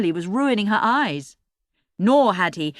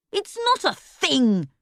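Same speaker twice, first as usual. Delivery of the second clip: angry; 8 semitones higher, slower than usual. A narrator's reading. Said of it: "Erg said angrily".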